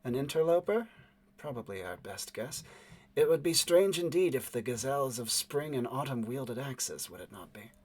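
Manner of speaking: soft, smooth, unhurried lady musing aloud; a poised woman, quietly amused; smooth woman's voice. Acted. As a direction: let it glide, cool and quiet. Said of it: "the smooth voice of the woman".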